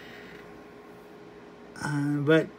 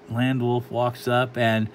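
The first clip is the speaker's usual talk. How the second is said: usual speaking voice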